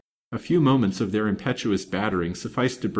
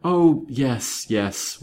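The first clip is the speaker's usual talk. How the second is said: mild whimper, high at first then sinking; meek, whimpered surrender; 4 semitones higher, 3 dB louder, much slower than usual. A narrator's reading. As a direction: give in like a frightened maid. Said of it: "whimpered Mary".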